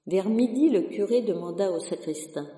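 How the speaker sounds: usual speaking voice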